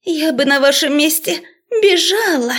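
высокое жалкое предостережение; предупреди высоким, жалобным голосом; жалобно. A narrator's reading. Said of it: "Голос Розали Грэдис звучал жалко". (жалобно, с тревогой)

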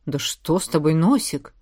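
low, gentle questioning voice. A narrator's reading. Man cooing to her.

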